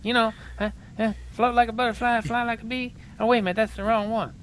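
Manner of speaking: high, lispy boxer voice; Mike Tyson impression. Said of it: "I have to talk like this to Mike Tyson".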